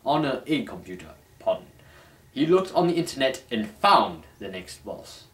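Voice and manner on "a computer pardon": normal talking voice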